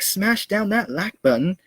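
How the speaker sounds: burping while talking; words pushed out on a burp. Real, not acted. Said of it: "Sorry, no, I'm burping here".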